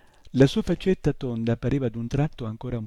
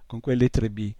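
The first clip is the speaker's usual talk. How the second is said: plain reading voice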